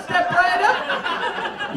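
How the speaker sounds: high, very slowly stretched barker's call; milk each word like a showman; a barker luring the crowd to the funny-face game, stretched and slow; drawn-out carnival barker. Retold or imitated. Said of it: "make that into a carnival game".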